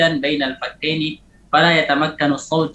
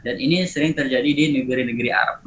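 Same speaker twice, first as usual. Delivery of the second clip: ordinary talk, nothing marked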